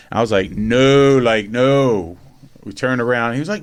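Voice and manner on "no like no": louder, flat refusal; firm retold no to the seller; emphatic no. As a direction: shut the idea down hard. Refusing a nineteen million house.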